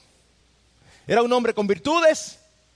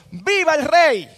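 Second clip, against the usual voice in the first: moderately raised, emphatic cry; crowd's acclaiming shout; 3 semitones higher, 4 dB louder than usual. Acted. People acclaim their new king, moderate shout. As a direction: proclaim it like a cheering crowd, not at full force. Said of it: "todo el pueblo gritó y dijo".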